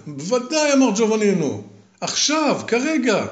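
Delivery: high, eager and impatient. Acted. Fearless young man urging the voice in the chimney, impatient. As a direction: urge it down right now.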